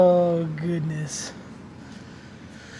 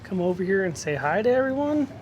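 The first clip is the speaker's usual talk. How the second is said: usual speaking voice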